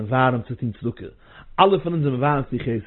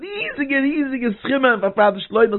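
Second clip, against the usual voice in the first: fiery preaching; 10 semitones higher than usual. Acted. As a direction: preach it out, urging them to give big. Fundraising appeal at full force.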